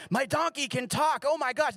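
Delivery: preacher hamming up Balaam's astonishment; mock shock. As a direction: play up amazed disbelief. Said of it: "Balaam shocked or surprised".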